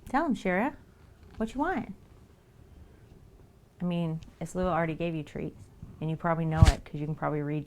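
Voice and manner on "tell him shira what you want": sweet cat-directed question; pet talk. Real, not acted. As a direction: ask the cat what she wants. Talking to her cat.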